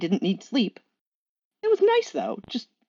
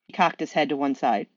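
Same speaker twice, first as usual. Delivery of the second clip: ordinary delivery, nothing marked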